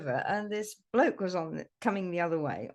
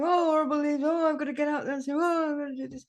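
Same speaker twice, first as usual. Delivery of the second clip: higher, mock moaning complainer; grumbling; 7 semitones higher, 5 dB louder, faster than usual. People giving out about fallen leaves, mock grumbling. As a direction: imitate someone fussing over a chore, voice pitched up. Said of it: "people giving out about the leaves".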